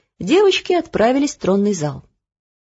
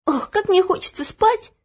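put-on higher girl's voice, yawning; sleepy girl; 11 semitones higher than usual. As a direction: play Olya still half asleep. (сонная девочка)

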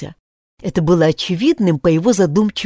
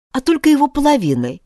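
usual speaking voice

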